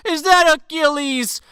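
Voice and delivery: loud, high, booming mock greeting; hammed-up swagger with a big laughing entrance; strutting villain. Alexander struts into the bar, hammed-up taunting villain voice. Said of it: "then Alexander fucking struts in".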